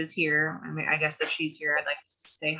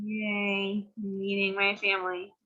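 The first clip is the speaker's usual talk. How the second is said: flat mock-cheerful yay; sarcastic cheer; 4 semitones higher, slower than usual. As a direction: say it with an eye roll. Sarcastic to friends.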